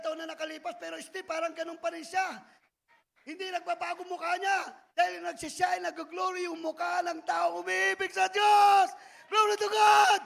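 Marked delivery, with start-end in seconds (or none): 3.3-10.2 rising from loud to a full shout; building to a shout. Real, not acted. Preacher shouting praise to the congregation, building loud. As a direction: lift them up to praise.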